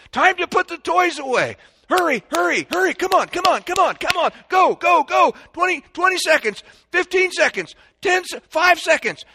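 high, loud, excited cheering-on; urgent countdown. Shown as a demonstration. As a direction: hurry the kids along like a race coach. Acting parent racing kids to tidy up.

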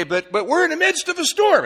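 high, loud worried objection; acted alarmed protest. Believer objecting to promised peace, in acted alarm. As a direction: blurt it out like a rattled doubter.

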